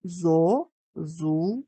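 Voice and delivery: slow, with a pause after each syllable; зо, зу, ля, each on its own. Shown as a demonstration. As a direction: break Зозуля into three separate beats. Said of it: "поділимо його на склади".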